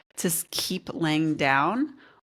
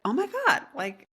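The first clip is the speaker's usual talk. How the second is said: shocked realization; 12 semitones higher, 3 dB louder than usual. Retold or imitated. Realizing own mistake retold.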